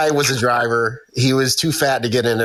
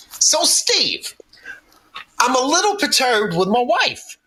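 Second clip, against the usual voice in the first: much higher, aggrieved coworker voice; Big Fat Jerry; 9 semitones higher than usual. Retold or imitated. Jerry complaining to Steve about his wife, high-pitched grumble. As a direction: complain like a put-upon husband. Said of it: "This dude goes".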